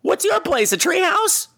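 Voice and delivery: incredulous. Retold or imitated. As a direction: act stunned, pitching way up. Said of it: "I'm like".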